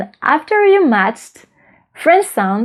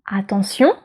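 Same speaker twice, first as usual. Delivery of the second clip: French "attention", last syllable stressed and rising; French final stress; 2 semitones lower, 3 dB quieter than usual. Shown as a demonstration. Teacher repeating the French example for learners, French final stress. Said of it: "See, it's on the last one".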